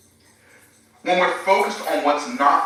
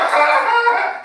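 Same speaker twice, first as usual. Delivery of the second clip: high burst of laughter; 5 dB louder than usual. Real, not acted. Laughing at own angel voice.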